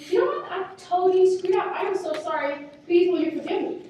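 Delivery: high, earnest mock apology; emphatic demonstration of saying sorry; acted apology. Acting out a model apology.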